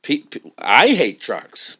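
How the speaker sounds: I hate trucks, with I punched; emphatic repeat. Acted. Guest repeats line with emphasis.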